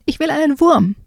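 put-on high whiny little frog kid; small son nagging his papa for a worm, high and whiny; whiny child. Child nags again.

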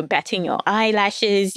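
ordinary delivery

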